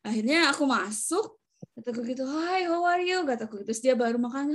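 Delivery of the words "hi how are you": higher-pitched mock hello; lifted, sing-out hello; retold friendly greeting. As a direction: act out greeting a patient, pitched up. Retold greeting on entering patient's room.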